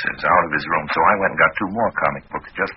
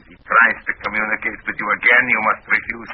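put-on commanding Russian accent, higher pitch; best Russian accent; 5 semitones higher than usual. Fake agent forbidding further contact, stern Russian accent. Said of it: "in my best Russian accent".

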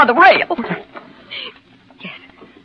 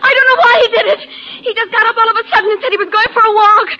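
tearful, raised voice; crying out; 4 dB louder than usual. Acted. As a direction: sob out the words, near shouting. Wife distraught after husband's jump.

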